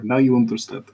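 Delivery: normal talking voice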